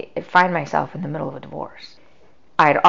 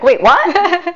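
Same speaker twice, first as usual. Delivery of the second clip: high, loud startled wait, what; stunned surprise; 12 semitones higher, 8 dB louder than usual. Retold or imitated. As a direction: jolt up in disbelief. Stunned reaction to family waiting.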